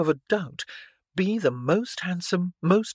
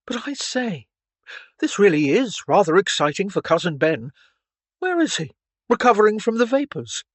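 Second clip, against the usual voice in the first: louder, lively mock excitement; dry teasing; 5 semitones higher, 6 dB louder than usual. A narrator's reading. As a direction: tease with a raised, animated voice. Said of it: "Clarence said dryly".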